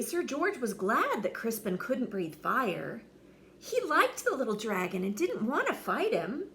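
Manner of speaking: ordinary delivery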